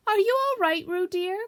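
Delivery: anxious call. A narrator's reading. Kanga calls to Roo in the stream, anxious and loud. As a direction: call out fretting for her little one. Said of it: "called Kanga anxiously".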